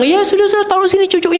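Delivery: light up like a grandma handed her grandchild; happy grandma. Retold or imitated. Grandmother gladly takes the grandchild, high and delighted. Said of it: "Ibunya kan seneng".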